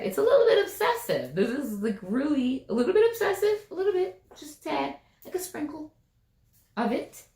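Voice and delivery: high, sing-song teasing; playful teasing. Real, not acted. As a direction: tease them gently about it. Teasing about the obsession.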